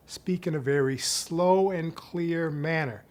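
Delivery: ordinary delivery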